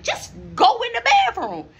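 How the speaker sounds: high shouted order; yelling. Retold or imitated. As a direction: bark the order mid-argument. They're literally yelling at each other.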